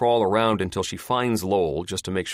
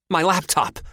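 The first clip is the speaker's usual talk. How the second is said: sudden higher, louder cry; exclaiming; 12 semitones higher, 6 dB louder than usual. A narrator's reading. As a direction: blurt it out in alarm. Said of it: "Doctor Probst exclaims".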